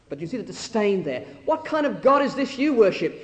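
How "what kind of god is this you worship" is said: venomous scorn. Acted. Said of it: "See the venom behind that?".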